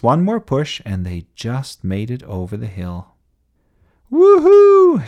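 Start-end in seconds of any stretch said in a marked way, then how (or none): 4.1-5.1 very high, loud cheer; shouting. A narrator's reading. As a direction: whoop it out in triumph. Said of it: "shouted the engines".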